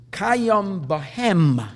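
Kayam bahem drawn out very slowly, word by word; slow quoted phrase. Shown as a demonstration. Teacher quotes the Medrash to students, slow and deliberate. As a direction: read the quote slowly, weighing each word.

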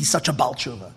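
usual speaking voice

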